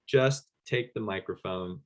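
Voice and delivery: ordinary talk, nothing marked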